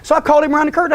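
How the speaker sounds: ordinary talk, nothing marked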